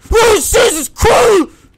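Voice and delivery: very high, loud, drawn-out scream; terrified shout. Real, not acted. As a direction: shriek at a sudden scare. Jump scare in the game.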